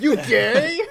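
high, loud mock taunt; bar bully. Joining in on the bar bullies' dialogue, mock jeer. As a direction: jeer it like a comic pub lout. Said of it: "a bunch of, like, assholes come over".

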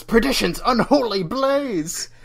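high, loud, drawn-out villain outburst; mock screaming Dracula. Host acting Dracula disturbed in his coffin, hammy screaming. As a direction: cry out like an outraged prince of darkness. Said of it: "he just starts screaming at them immediately".

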